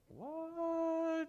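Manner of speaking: long, very high drawn-out question; comic amazement. Retold or imitated. Patient peering out at the empty hospital, high amazed question. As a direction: stretch it out high in mock wonder.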